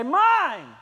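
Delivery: loud, high possessive yell; childish mock shout; clinging shout. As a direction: grab it back selfishly. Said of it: "you cling to it and you say".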